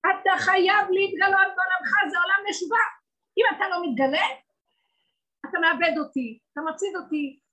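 loud, high pleading sermon; fervent preaching. Acted. Preacher pleading with God before the congregation, loud and urgent. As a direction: cry out to God to reveal himself.

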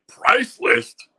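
high, loud disbelieving echo; can't believe he has to pay; incredulous. Retold or imitated. Actor hears he must pay, echoes it high and loud in disbelief. Shocked at being charged.

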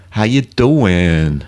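slow, drawn-out New York "how you doing?"; New York accent. Shown as a demonstration. Host greeting daughter, slow mock New York accent. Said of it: "Or as they say here in New York".

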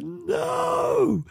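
long, very high no; mock wail of protest. Everybody hearing the fixed version, high mock wail.

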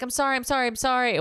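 high-pitched mock apology; apologetic waiter. Waiter apologising to angry guest, mock contrite. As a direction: mimic a repeated, hasty backdown.

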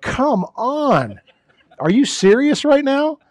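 high, drawn-out cry of disbelief; exasperated yell. Pretend. Pastor imagining himself bitten, yelling skyward in disbelief. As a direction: yell it up at the heavens, fed up. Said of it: "I would have just screamed".

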